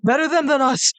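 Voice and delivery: high, selfish refugee cry; crowd voice. Acted. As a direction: shout from the crowd.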